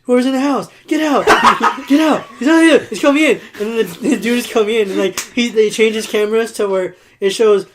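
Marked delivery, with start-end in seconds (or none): 0.0-3.4 higher and louder frantic warning; loud, frantic warning at the screen; mock warning shout. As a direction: shout at the TV to save them. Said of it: "He's like".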